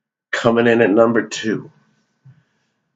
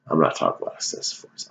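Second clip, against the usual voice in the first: quick, much softer whisper; a fast secretive whisper; 10 semitones lower, 12 dB quieter, faster than usual. Acted. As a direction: rush it out in a hushed whisper. Aside to viewers.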